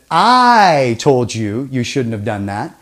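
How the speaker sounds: "I" leaned on, then rising at the end; stress on I. Shown as a demonstration. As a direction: pin it on "I", slowly. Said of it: "it means something else".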